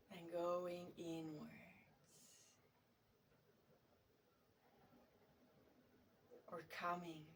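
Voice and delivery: guided meditation. Real, not acted. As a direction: settle into soft, unhurried guiding, letting phrases hang.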